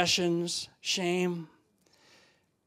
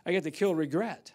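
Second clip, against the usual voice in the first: ordinary delivery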